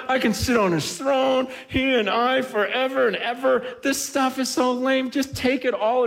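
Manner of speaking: high, fervent sermon voice; impassioned preaching. Acted. Preacher voicing one who found the treasure, fervent. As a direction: pour it out with urgency.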